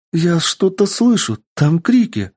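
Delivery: put-on high, alarmed elf girl exclaiming; christmas elf. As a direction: cry out high, pointing to the forest. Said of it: "воскликнула эльфийка".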